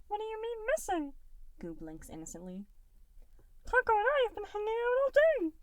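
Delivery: a tiny blob kid, sweet and distracted; little gelatinous Goo. A narrator's reading. Little Goo putting off dinner, high and bright. Goo's voice.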